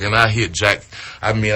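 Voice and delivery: normal talking voice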